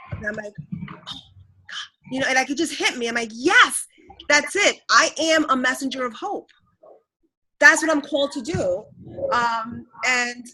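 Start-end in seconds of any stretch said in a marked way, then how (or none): 0.8-1.9 awed whisper. Retold or imitated. Retold moment of revelation. 3.3-8.8 excited realization. Retold or imitated. Retold joyful realization.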